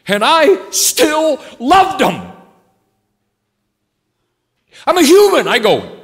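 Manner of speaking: high, loud, driving preacher's cry; preaching at full force. Acted. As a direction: build it into a soaring preaching climax. Preaching climax rising loud.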